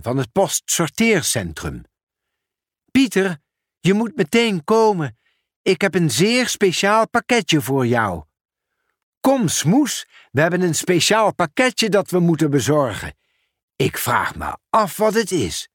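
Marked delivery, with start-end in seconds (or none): 2.9-8.2 higher, eager colleague voice; a friendly raised voice with urgent news; Ben the sorter. Acted. Ben calls Pieter about an urgent parcel, higher and eager. 9.2-15.7 lightly raised, cheerful postman; bright call to the cat, curious; cheerful Pieter. Acted. Pieter tells Smoes about the parcel, lightly raised and cheerful.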